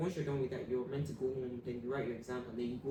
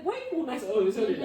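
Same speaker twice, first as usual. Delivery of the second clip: much louder, higher wary challenge; suspicious; 7 semitones higher, 10 dB louder than usual. Retold or imitated. Girl confronting overly nice classmates, loud suspicious challenge. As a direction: call them out, half joking, half suspicious.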